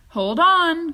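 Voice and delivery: loud, high warning call; sudden loud cry. A narrator's reading. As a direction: brace them for the launch. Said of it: "cried Mister Wonka".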